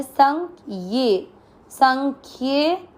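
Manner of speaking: संख्ये slowly, half ख joined to ये; slow syllable reading. Shown as a demonstration. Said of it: "ख आधा है". (संख्ये धीरे-धीरे अक्षर जोड़कर)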